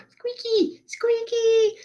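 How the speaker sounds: very high, slow squeaky character voice; tiny squeaky improv character voice. Shown as a demonstration. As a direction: squeak it out way up high like a cartoon mouse. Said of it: "I chose as squeaky".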